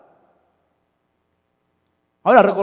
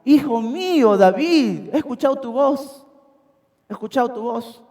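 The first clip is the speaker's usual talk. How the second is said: higher, honeyed mock tenderness; sweet words that hide hatred; falsely sweet; 6 semitones higher than usual. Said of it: "disimulando sus palabras de una manera tan bonita".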